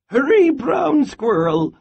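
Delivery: loud, high urgent call. A narrator's reading. Grandmother calls to squirrel from the door, loud and high. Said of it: "she called".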